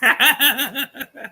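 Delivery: short high-pitched chuckling burst; high laugh. Real, not acted. Host tickled by his friend's son, high laugh. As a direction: crack up briefly, high and rapid.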